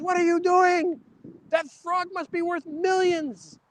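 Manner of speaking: high, astonished disbelief, slowing on the second line; shocked bartender. Acted. Bartender stunned as the frog is sold off cheap, high and incredulous. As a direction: gasp it out like you can't believe it.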